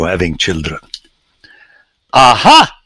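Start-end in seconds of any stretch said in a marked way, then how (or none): 2.1-2.8 loud, high eureka shout; triumphant aha. Real, not acted. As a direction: crow it like a discovery. Lecturer discovers theological point.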